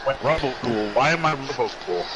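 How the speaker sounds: ordinary talk, nothing marked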